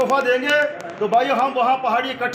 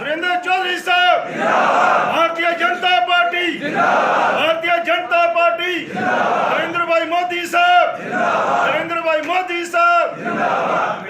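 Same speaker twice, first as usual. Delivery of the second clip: slogan shouting; 5 semitones higher than usual. Real, not acted. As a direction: lead the crowd with each cheer. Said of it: "मैं दो तीन नारे लगाऊंगा".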